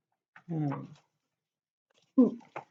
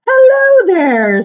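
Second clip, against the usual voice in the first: higher, louder, slow drawn-out hello; rock's greeting; 11 semitones higher, 14 dB louder, much slower than usual. Acted. Second rock greeting the first after centuries, slow high hello. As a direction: greet your old friend with slow delight. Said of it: "said he".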